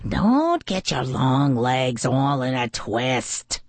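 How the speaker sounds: low, slow weasel voice; Snout sneering. A narrator's reading. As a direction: drag it out low and dismissive. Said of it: "Snout said".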